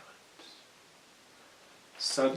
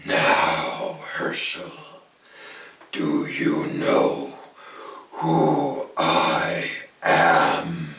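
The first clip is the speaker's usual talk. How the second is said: much lower, loud and very slow; menacing goblin king; 9 semitones lower, 8 dB louder, much slower than usual. Acted. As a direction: drag out each word to loom over him. Goblin king looms fully lit.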